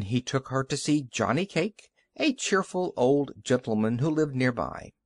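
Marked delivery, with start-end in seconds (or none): none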